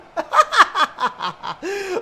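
high cackling laugh; real high-pitched laughter; burst of laughter. Real, not acted. Laughs at waddling chickens.

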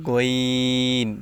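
long, held গইন from the end of the throat; slow demonstration of throaty গইন; end-of-throat letter. Shown as a demonstration. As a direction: stretch গইন out slowly, made at the throat's end. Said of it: "হলকের শেষ ভাগ হইতে গইন".

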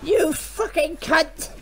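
outraged shout. Real, not acted. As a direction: bellow your outrage at him.

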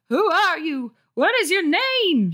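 high, slow, shrill demand; demanding mom. Acted. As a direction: interrogate her like an angry mother. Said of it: "she demanded".

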